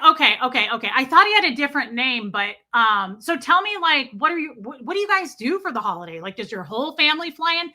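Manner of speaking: loud, quick oblivious chatter; imitating shouting phone talker. Customer chatting about holidays on speakerphone, loud imitation. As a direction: rattle on loudly as if nobody else is there. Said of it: "I'm shouting at you guys right now".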